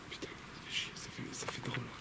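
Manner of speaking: uneasy unvoiced whisper; whisper, spooked and shaken; scared whisper. Real, not acted. Spooked in dark forest. (chuchotement effrayé)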